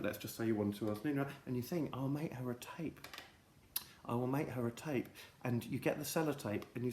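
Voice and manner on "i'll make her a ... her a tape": quiet resolve. Retold or imitated. Resolve, whispered thought.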